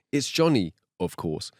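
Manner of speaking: normal talking voice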